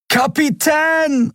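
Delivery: very high, drawn-out cry of alarm; desperate shout. A narrator's reading. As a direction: call out slowly stretched, high and urgent. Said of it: "rief Miko und sprang". (hoher, lang gezogener Warnruf)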